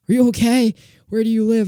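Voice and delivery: high, loud caring questions; a worried adult checking on a kid; concerned neighbor. Retold or imitated. Neighbor asks the hurt kid where home is, high and concerned. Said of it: "they were like".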